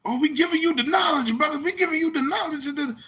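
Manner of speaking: high lofty put-on voice; mock guru. Mocking debate speakers preaching knowledge, high and lofty. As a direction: hand out wisdom pompously.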